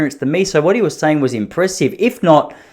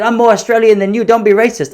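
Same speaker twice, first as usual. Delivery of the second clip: higher, louder indignant retort; mock offended vendor; 8 semitones higher, 4 dB louder than usual. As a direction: play the vendor taking offence. Vendor bristling at the question.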